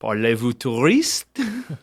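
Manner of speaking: slow parlez-vous touriste in mock French; drawn-out mock French question. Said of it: "Do You Speak Tourist?".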